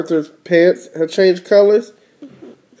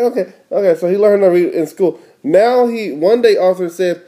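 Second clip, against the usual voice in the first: ordinary delivery, nothing marked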